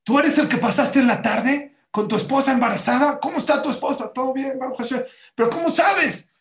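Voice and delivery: high, loud rush of eager questions; excited questioning. Retold or imitated. Man at night questions the passer-by, excited questioning. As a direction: blurt it out high and loud, amazed.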